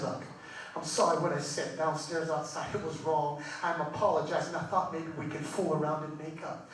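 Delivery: low, soft, sheepish husband; subdued, low-voiced acted apology; quiet apology. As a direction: apologize softly under your breath in bed. Husband's first, quiet bedtime apology.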